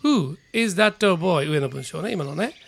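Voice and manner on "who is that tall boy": slow, stress on "Who"; teacher's slow example sentence; slow model reading. Shown as a demonstration. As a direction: open with a clear "Who". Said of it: "Whoで文を始めます".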